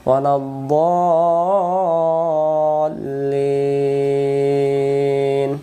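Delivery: দলিন recited with very long held vowels; four-alif madd. Shown as a demonstration. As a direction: chant it slowly, stretching both vowels of দলিন. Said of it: "এভাবে পড়বো আমরা". (দলিন লম্বা টানে তিলাওয়াত)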